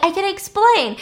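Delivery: high and loud, suddenly pleading; urgent high plea. Retold or imitated. The avenger urgently stopping her boyfriend, high loud plea. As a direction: stop him, urgently. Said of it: "She's like".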